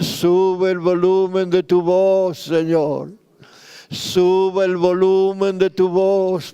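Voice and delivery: impassioned prayer, pitch climbing as it builds; a pastor pleading aloud for revival, slow and rising; fervent preaching prayer. Acted. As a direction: cry out to God with growing urgency, drawing out the pleas.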